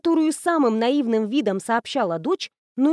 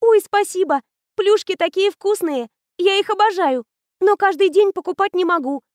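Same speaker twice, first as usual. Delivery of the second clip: put-on higher and louder, gushing child's joy; clap-happy girl thrilled by free buns; girl thanks the principal in delight; 6 semitones higher, 3 dB louder than usual. A narrator's reading. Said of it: "захлопала в ладоши".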